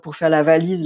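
ordinary talk, nothing marked

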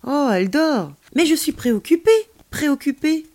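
put-on high, loud, urgent kid voice; worried child character. A narrator's reading. Child realising it is late for grandmother, anxious.

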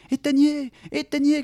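high frantic cry; panicked high cry to turn off the fire; shouted plea. A narrator's reading. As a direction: scream it out from inside the tree. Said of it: "cria Mary".

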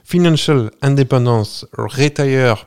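slow, French-tinged attempt at English; attempted English accent. Shown as a demonstration. Host warns listeners about the English accent, then attempts English slowly. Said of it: "alors attention mon accent anglais".

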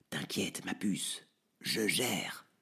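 whispered, barely voiced, to the girl. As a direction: hush it so the father sleeps. Franck whispers at door. (chuchoté tout bas pour ne pas réveiller le père)

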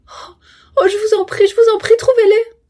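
desperate, very high, loud plea; anguished cry. A narrator's reading. Cousin pleading with him to find the money, anguished. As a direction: beg in a cry of suffering. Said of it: "une sorte de cri de souffrance".

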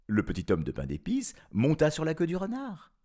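ordinary delivery

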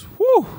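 high, loud amazed whew. Real, not acted. Host recovering from laughing, loud high whew. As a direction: let out a big whew. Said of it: "that was comic relief".

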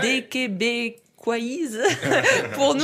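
stretched-out laughing coinage, then giggling; laughing through words. Real, not acted. Teasing a Québécois friend about his accent, laughing.

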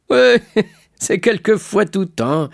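high, drawn-out chuckling reply; laughing. A narrator's reading. Shipowner teasing the sailor about his fiancée, laughing. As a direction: laugh through the teasing line. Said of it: "dit l'armateur en riant".

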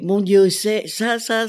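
sudden high, loud cry of delight; thrilled exclamation on seeing the paintings; excited gasp. Retold or imitated. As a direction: blurt it out as if struck by joy.

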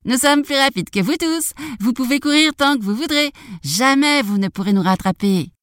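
high, cocky gingerbread voice; taunting boast. Acted. Gingerbread man taunts everyone from the fox's back, high boast.

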